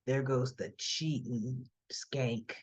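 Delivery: 低く、ゆっくり、見下すように; 浮気した元妻を見て心の中で毒づく、低くゆっくりした軽蔑の声; low sneering mutter. Retold or imitated. Imagined sneer from the ex-husband.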